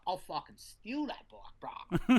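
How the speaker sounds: slow, offbeat jokey threat in a put-on voice; joking threat. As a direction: drawl it out as a half-joking tough guy. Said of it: "the way I said it was just, it was funny".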